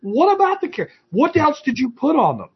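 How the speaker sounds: very high incredulous question; hammed-up confused self; mock bafflement. Retold own baffled reply.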